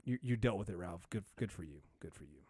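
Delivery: low, soft, grudging praise; murmured faint praise for an actor; imagined viewer reaction. Retold or imitated. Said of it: "And so you're like".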